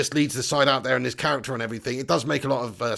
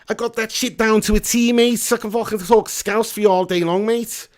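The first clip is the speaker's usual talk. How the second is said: hammed-up Scouse with a raised voice; Scouse accent; 4 semitones higher, 4 dB louder than usual. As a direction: put on a cocky, thick Scouse. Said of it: "if it's a Scouse accent you want".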